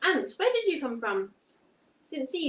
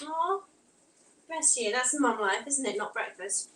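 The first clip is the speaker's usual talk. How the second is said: normal talking voice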